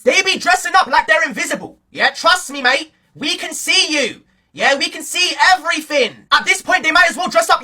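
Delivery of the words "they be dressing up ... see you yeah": ordinary talk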